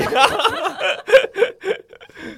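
high loud laugh. Real, not acted. Host laughs after joke.

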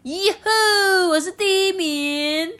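excited frog cheer. Acted.

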